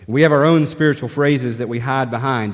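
normal talking voice

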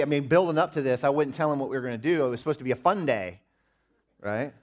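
ordinary talk, nothing marked

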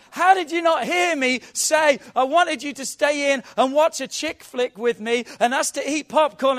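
loud, high, indignant yelling; furious shout. Acted. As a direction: shout the hurt complaint right at him. Acted wife's quarrel, shouting.

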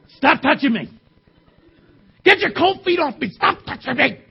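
put-on whiny kid voice, rising complaint; whiny tattling little sibling. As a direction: whine like a cranky little brother. Sibling fight in bed.

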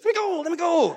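high, loud, but pinned down and helpless; weaker angry shout. Retold or imitated. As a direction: shout while held in a bear hug. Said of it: "It was more like this".

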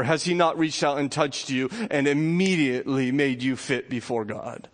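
ordinary talk, nothing marked

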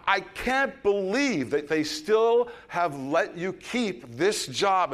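high, loud, drawn-out tirade, each insult hammered; losing it at waiter. Retold or imitated. Diner finally tells off the terrible waiter, losing it. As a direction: act out an exasperated diner's outburst, word by word. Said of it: "somebody that I'm with loses it".

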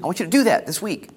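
loud, high, rising sermon call; preaching. Acted. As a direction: build the exhortation with fire. Rising exhortation to the congregation.

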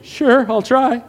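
reluctant new guy. Retold or imitated. New guy asked to pray, answers high and hesitant. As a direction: squeak out a nervous agreement. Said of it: "they're like".

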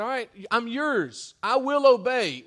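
loud, high cry of surrender; fervent vow. Retold or imitated. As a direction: proclaim it like a believer giving in. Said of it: "confessed with the mouth and said".

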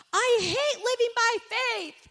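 high, loud, slow wailing complaint; whiny wail. Retold or imitated. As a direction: wail it like a fed-up child.

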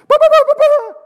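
very high, loud mock stammer; flustered babble. Shaken rider asked what his problem is, loud panicked babble.

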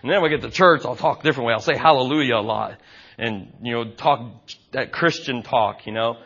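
a lightly voiced shrug of a hypocrite. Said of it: "I'd be like".

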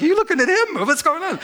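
high-pitched comic suspicion; incredulous, playful mock accusation; jealous disbelief. Retold jealous suspicion of fiancée.

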